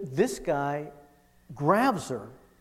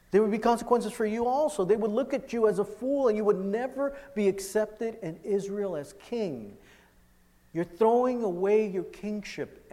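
higher, insistent acted plea; urgent warning; 7 semitones higher, 3 dB louder than usual. Tamar warning Amnon he would never be king, urgent warning. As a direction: press each consequence home with raised pitch.

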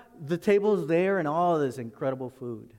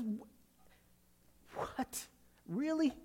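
a breathless gasp then a high really; stunned disbelief; 7 semitones higher, 9 dB quieter, much slower than usual. Acted. Paul hearing of drunkenness at the supper, stunned disbelief. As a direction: caught completely off guard.

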